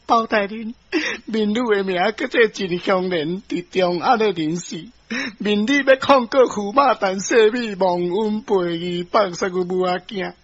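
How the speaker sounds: petition the judge as a wronged wife; wronged wife pleading. Acted. (苦情民女哀求的口氣)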